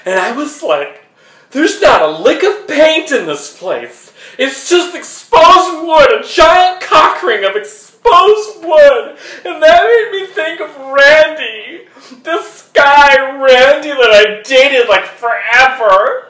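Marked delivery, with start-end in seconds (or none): 1.5-9.2 much higher and louder, "exposed" dragged out slowly; "It's just exposed wood" wailed slow, high and loud with a laugh; overwrought outburst. Acted. Telling the audience about panicking beside his date, higher and louder outburst. 9.5-16.3 mock sobbing. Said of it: "I'm sad".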